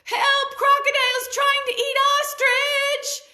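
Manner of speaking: very high, loud cry for help; alarmed shout. A narrator's reading. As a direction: raise the alarm in a panic. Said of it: "and he said".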